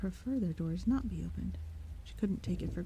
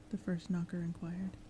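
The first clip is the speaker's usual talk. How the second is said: ordinary delivery, nothing marked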